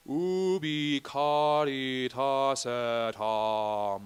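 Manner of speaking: ubi caritas et amor, very slow and drawn out; slow Latin diction. Shown as a demonstration. Director models the phrase for choir to repeat. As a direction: model each Latin syllable slowly. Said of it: "So repeat after me".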